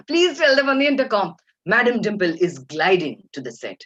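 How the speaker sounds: grand, amused mock command; Dimple Kapadia. Star instructing assistant over intercom, imitated imperious manner. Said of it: "she looked at the A D M and she said".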